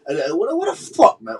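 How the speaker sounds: ordinary talk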